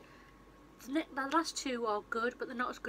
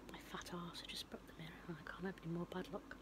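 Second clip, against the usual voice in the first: very low, hushed grumble; half-whispered aside to yourself; real under-the-breath self-reproach; muttering to self; 4 semitones lower, 12 dB quieter than usual. Real, not acted. Muttering to self after breaking mirror.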